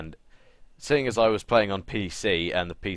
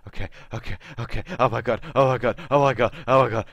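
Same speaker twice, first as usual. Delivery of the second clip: high, louder, hammed-up panicking; mock panic; 5 semitones higher, 5 dB louder, faster than usual. Heroine freaking out after her first kill, mock panic. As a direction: imitate someone working themselves into a panic.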